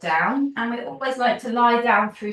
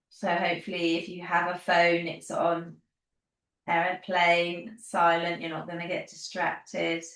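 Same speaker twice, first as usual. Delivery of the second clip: guided relaxation; 3 dB quieter than usual. Acted. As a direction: settle them gently into the body, unhurried. Leads a guided body relaxation.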